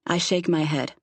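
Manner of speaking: usual speaking voice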